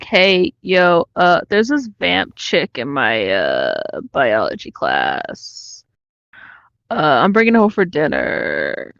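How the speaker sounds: surfer dude. Retold or imitated. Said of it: "does Edward talk like a surfer dude".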